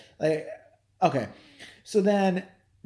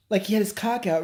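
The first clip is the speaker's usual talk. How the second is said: ordinary talk, nothing marked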